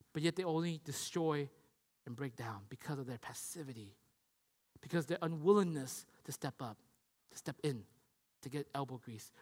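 low, soft rebuke in measured phrases; sombre preaching. Real, not acted. Preacher lamenting the brothers' passivity, hushed and sombre. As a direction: let the volume fall away gravely.

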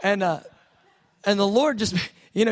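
chuckling through the words; real laughter while talking; laughing. Real, not acted. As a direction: laugh as you go on. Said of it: "laughs while telling".

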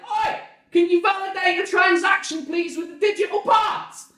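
loud, high holler; shouted request. Retold or imitated. Husband asks wife in the house for help, shouting. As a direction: yell it across the garden.

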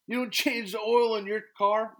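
high, loud stunned question; mock disbelief. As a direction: act floored that anyone skips oil changes.

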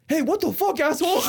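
high, loud retold challenge; mock angry yell. Quoting dad confronting the man, mock angry yell. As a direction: imitate an indignant shout, cracking up at the end.

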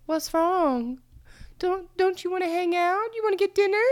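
high, small mock-sweet voice; self-mocking high-pitched plea; meek pleading. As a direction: coax timidly, mimicking your clingy self. Mocking her own meek pleading.

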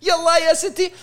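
high loud laughing cheer; mock cheer. Joking with her friend about cheering City, laughing cheer.

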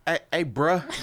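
teasing Cali "bruh" imitation. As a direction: call out in a put-on Cali accent. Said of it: "The accents. You don't like the accents".

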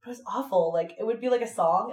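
usual speaking voice

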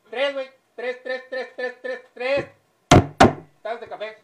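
high, loud insistent repeats; loud demanding order. Acted. Customer loudly ordering three coffees. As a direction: bark the order at the waiter.

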